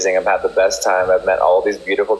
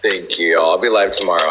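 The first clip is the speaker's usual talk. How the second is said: ordinary delivery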